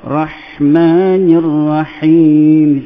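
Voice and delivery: ordinary talk, nothing marked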